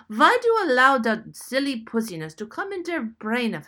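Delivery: put-on loud, scornful old German painter; German-accented derisive tirade; German accent shout. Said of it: "shouted his contempt and derision".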